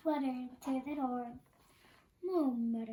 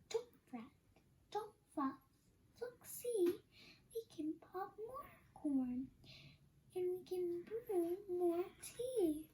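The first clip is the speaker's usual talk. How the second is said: higher, soft little mouse voice; squeaky mouse; 5 semitones higher, 5 dB quieter than usual. Acted. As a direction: coax gently like a tiny mouse. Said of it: "Mouse squeaks".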